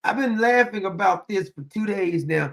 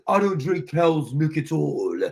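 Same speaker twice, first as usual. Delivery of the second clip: low, put-on British accent; mock British; 5 semitones lower than usual. Imitating Clever refusing regular milk, mock British. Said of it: "She went very British".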